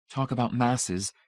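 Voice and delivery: lightly voiced, half-hearted quip; a touch of weary sarcasm. A narrator's reading. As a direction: toss it off without spirit. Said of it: "Marcia said half-heartedly".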